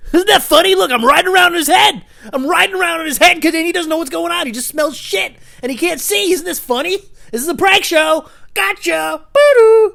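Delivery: very high, loud obnoxious prankster; mock prank-show host. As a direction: crow it like an overexcited prank host.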